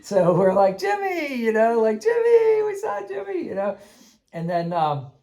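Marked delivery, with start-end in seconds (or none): 0.8-3.4 high, delighted calling out of a name; excited calling. Retold or imitated. Players greeting the recurring spider, excitedly calling out. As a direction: call Jimmy like spotting an old friend.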